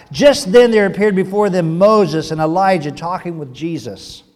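loud, measured Bible reading; scripture reading. Acted. Pastor reading Matthew to the church, loud and solemn. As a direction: proclaim the passage to the congregation.

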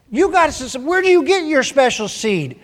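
a nosy little rabbit begging for secrets; pestering rabbit. Acted. Rabbit voiced pestering Squirrel.

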